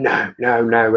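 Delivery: ordinary delivery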